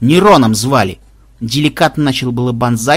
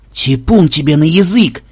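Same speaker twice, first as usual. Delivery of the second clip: usual speaking voice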